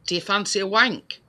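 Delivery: usual speaking voice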